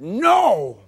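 put-on high, loud defiant shout of a little boy; angry child's no. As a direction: yell it back like a furious toddler. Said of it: "He was mad".

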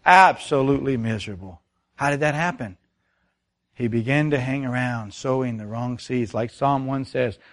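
ordinary talk, nothing marked

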